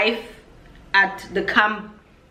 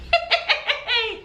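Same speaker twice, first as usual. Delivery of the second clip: laugh; 14 semitones higher than usual. Real, not acted. Laughing at her own fear.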